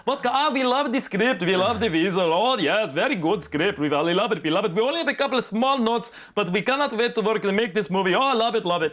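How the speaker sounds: high, hammed-up German accent, bouncy and eager; Hans and Franz German accent. German directors gushing over the script, hammed-up German accent. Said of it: "directors sound like Hans and Franz".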